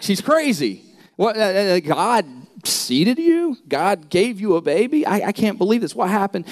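a hint of a stunned fiancé who can't believe it; incredulous Joseph. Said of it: "He's like".

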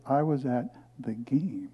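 slow hushed whisper, like a secret; slow conspiratorial whisper of a proud secret. Retold or imitated. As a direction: breathe it out smugly, slowly. Secretive answer to the crowd.

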